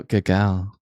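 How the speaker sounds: a lightly voiced teasing praise; praising voice. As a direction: say it like praising a pet, low and sweet. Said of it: "how about if I go".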